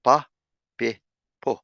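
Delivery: "pa, pe, po" said clearly; short vowel syllables. Shown as a demonstration. Teacher repeating the p syllables. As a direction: drill "pa, pe, po" one by one. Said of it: "We're gonna get".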